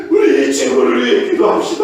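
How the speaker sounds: rally the group to pray for him; loud call to prayer. Retold or imitated. Pastor urges campers to pray for the boy, loudly. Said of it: "라고 하면서".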